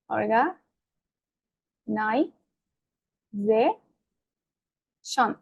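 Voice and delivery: অর্গা, নাই, যে, শন said as separate chunks with long pauses; অর্গা... নাই... যে... শন, each part standing alone; broken into syllables. Shown as a demonstration. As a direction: spell out অর্গা, নাই, যে, শন piece by piece, softly. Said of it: "বলতে পারি ভেঙে ভেঙে".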